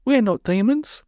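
indignant, high-pitched protest; high put-on voice, affronted; Polly. A narrator's reading. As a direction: set them straight, high and huffy. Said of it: "said Polly indignantly".

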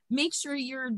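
normal talking voice

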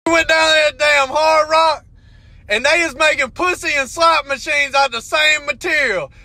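ordinary talk, nothing marked

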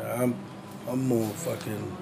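normal talking voice

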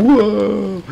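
mock class gasping whoa, high; a high impressed whoa from the class. The whole class reacting to the teacher, high impressed whoa. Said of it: "And everybody's like".

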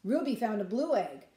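plain reading voice

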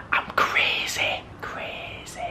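slow, hushed whisper fading away; playful mad whisper; crazed whisper. Pretend. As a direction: whisper it slowly, trailing into almost nothing. Mock-crazy aside after outburst.

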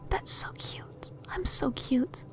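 soft, slow voiceless coo; cooing whisper. Real, not acted.

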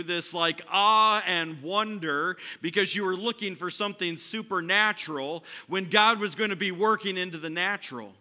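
loud, high, driving preacher voice; full-force preaching. Acted. Preacher challenging listeners, loud and high. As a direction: push the challenge hard at the congregation.

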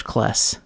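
"kless" with an eh vowel for class; demonstrated Cincinnati vowel in "kless"; Cincinnati short A. Shown as a demonstration. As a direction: say "kless" to rhyme with bless. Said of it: "The classic Cincinnati accent".

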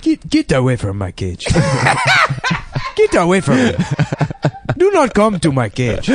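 mock surly parrot with an African accent. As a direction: squawk it like a grumpy African parrot. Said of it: "a voice of him".

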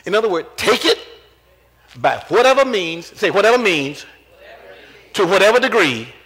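loud, driving sermon delivery; rising preacher's fervor; full force preaching. Acted. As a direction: preach it hard to the congregation. Preaching whatever means, I am the way.

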